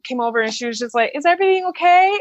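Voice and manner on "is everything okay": high-pitched voice of a waitress checking in; imitated concerned waitress. Waitress checks on a crying diner, imitated high concern. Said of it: "the waitress, bless her heart".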